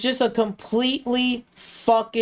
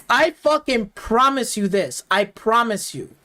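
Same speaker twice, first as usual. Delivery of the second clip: usual speaking voice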